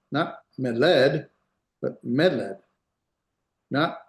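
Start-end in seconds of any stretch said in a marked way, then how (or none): 0.6-1.3 meled with the accent moved onto the last syllable, led; wrong final-syllable stress. Shown as a demonstration. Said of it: "Hear the difference?". 2.0-2.6 meled with the accent on the first syllable, me; stress on first syllable. Shown as a demonstration. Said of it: "accent here".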